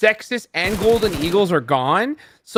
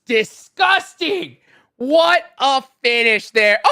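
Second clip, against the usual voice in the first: slow, stretched hype; hyped shout; 7 semitones higher, 4 dB louder, slower than usual. Real, not acted. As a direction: drag out the awe. Caster reacts to the finish.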